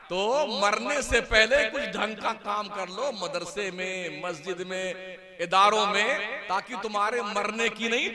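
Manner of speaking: a preacher stirring the crowd from the pulpit; full-force Urdu sermon, swelling and falling; fiery sermon. Acted. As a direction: preach it loud and high, rising to shouts. Preaching to a gathering.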